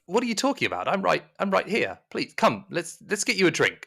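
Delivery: high flustered servant; high, fussing Jeremiah. Acted. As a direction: brush it off and offer a drink. GM voices Jeremiah soothing.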